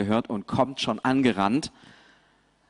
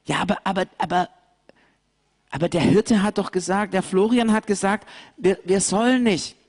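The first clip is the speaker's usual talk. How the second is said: high, stammering little lamb, fast; a nervous lamb torn between yes and no; hesitant lamb; 9 semitones higher, faster than usual. Acted. Lambs hesitate, remembering the shepherd's warning, high and quick.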